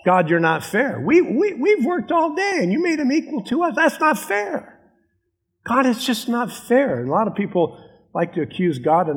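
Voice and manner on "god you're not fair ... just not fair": whiny complainer. Retold or imitated. Workers grumbling to God about equal pay, in a high whiny mock voice. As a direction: whine it like a sulking child who feels cheated. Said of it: "They're basically saying".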